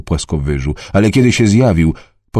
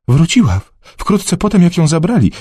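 high, eager voice of a worried mother; a village mother speaking up for her daughter; Jess's voice; 11 semitones higher than usual. Acted. Jess explains daughter's return. (wysoki głos zatroskanej matki)

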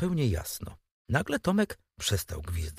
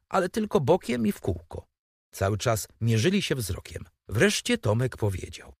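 ordinary delivery

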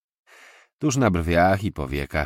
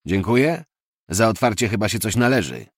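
mocking echo; 5 semitones higher, 6 dB louder than usual. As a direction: echo the thanks back with a louder, mocking rise. (szydercze powtórzenie, głośniej, z pytającą intonacją)

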